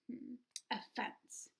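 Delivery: quiet pondering hmm; thoughtful. A narrator's reading. Stanley thinking hard before answering, quiet and thoughtful. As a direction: mull it over softly. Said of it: "Stanley thought hard".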